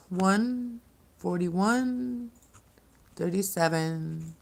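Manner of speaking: each number stretched very slowly; slow, drawn out. Shown as a demonstration. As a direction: draw the numbers out, high and slow. Repeats the time slowly.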